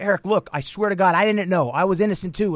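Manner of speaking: high, loud mock pleading; imitated begging. Ray begging mid-beating, high imitated pleading. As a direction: plead your innocence in an imitated voice. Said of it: "here's Ray begging me".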